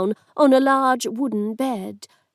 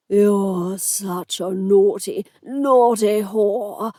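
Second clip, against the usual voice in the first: put-on slow, slurred rasp of a drunken lord; raspy; 3 semitones lower, much slower than usual. A narrator's reading. Drunken lord pins down the woman, slow rasp. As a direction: draw it out in a lecherous rasp. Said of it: "he rasped".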